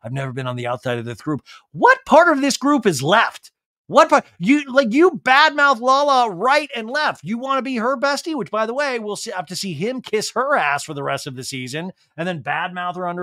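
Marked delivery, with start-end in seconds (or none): 0.0-1.4 low, quiet whiny mimic; mock his self-pity; mock mopey voice. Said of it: "I'm like". 1.7-8.7 loud, high exasperated rant; indignant rant. Real, not acted. Ranting at Tom.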